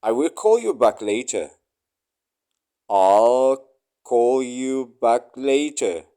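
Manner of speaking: ordinary talk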